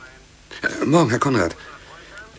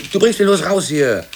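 angry outburst; 9 semitones higher, 7 dB louder than usual. Acted. Sudden outburst at colleague.

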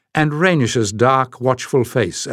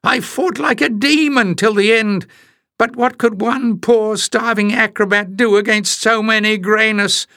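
very high, slow, whiny wail; wailing acrobat; 10 semitones higher than usual. Acted. Acrobat on the floor pleading his innocence: a high whiny wail. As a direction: wail it like a pitiful little trickster.

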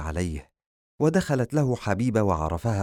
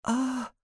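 high little female "ah"; small high cry of pain; pained female gasp; moan of pain; slower than usual. A narrator's reading. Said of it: "وتأوهت".

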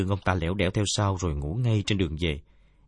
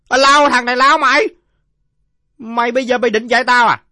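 very high, full-volume yell; enraged drunkard; 13 dB louder than usual. Acted. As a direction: explode at the insolent kid. (giọng ông say nổi khùng, gào thật cao)

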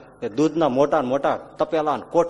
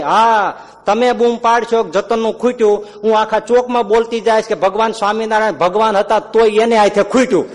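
louder, higher defiant housewife threat; defiant devotee woman; 6 semitones higher, 6 dB louder than usual. Acted. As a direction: threaten him boldly. (ભક્ત સ્ત્રીનો પડકારભર્યો અવાજ)

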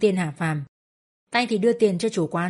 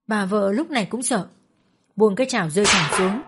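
plain reading voice

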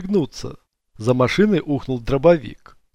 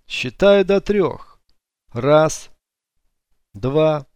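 slow countdown; 4 semitones higher, much slower than usual. Acted. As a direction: count down slowly and menacingly. Police countdown.

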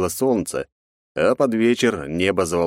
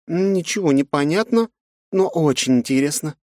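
thoughtful; 10 semitones higher than usual. Acted. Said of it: "задумчиво произнесла Жанна".

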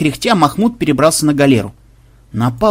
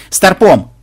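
loud, higher one-word call; summoning call; 5 semitones higher, 6 dB louder than usual. Acted. Captain summoning his first mate, loud raised call. Капитан зовёт старпома.

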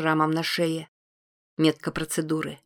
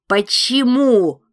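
much higher, slow, loud demand; furious demand; 6 semitones higher, 9 dB louder, much slower than usual. A narrator's reading. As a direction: drag the word out in fury. Repeated demand squeezing throat.

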